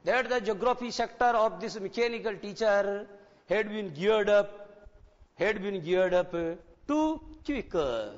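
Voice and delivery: very slow, deliberate read-aloud with heavy stress; spell-it-out classroom reading pace; slow textbook reading. Shown as a demonstration. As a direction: read each English word out slowly for the class. Teacher reads textbook sentence slowly.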